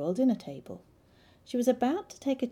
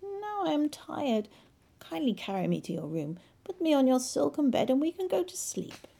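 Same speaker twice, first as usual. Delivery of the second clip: high frog voice; 8 semitones higher than usual. Acted. Frog demands bed.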